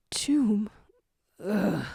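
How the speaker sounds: breathy last word, almost gone; a dying jackal's final whispered word. A narrator's reading.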